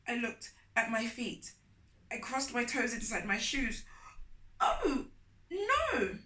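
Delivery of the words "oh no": high, loud fake dismay; mock dismay. A narrator's reading. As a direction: act shocked to hide guilt. Child fakes dismay.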